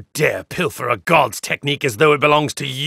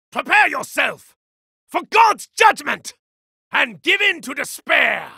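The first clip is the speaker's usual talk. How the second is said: furious god's proclamation; shouted taunt; 11 semitones higher than usual. Acted. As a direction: hurl it at the enemy. Battle taunts.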